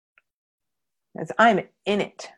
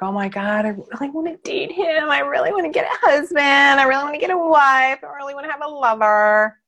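higher, dreamy mock-swooning voice; mock lovesick single; 4 semitones higher, faster than usual. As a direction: parody someone desperate to date. Mocking a single person's longing.

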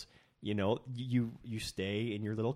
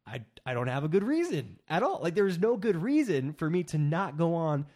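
emphatic admission; 7 semitones higher, 6 dB louder than usual. Real, not acted. Admitting he had no reason.

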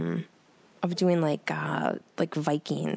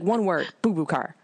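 put-on firm little kid voice; a hint of a stubborn child; insistent child. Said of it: "She was like".

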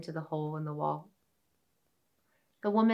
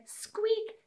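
very high tiny squeak; a quick high mouse squeak. Acted. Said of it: "she quickly said".

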